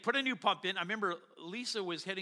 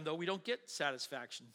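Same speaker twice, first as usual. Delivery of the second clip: usual speaking voice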